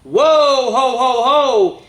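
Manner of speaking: loud, high whoa ho ho ho; joyful whoop. Acted. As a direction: burst out with delight like a dancing reindeer. Reindeer starts dancing on ornaments.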